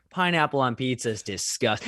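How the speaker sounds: ordinary talk, nothing marked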